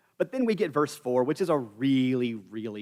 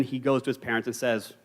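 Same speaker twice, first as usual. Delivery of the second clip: normal talking voice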